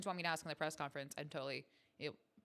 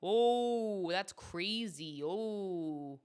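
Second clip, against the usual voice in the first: put-on proudly overdone Canadian accent; hammed-up Canadian accent; 6 dB louder, slower than usual. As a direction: stretch the vowels like a cartoon Canadian. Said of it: "what are other things Canadians say?".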